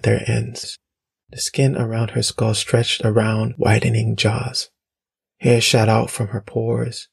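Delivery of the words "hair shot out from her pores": plain reading voice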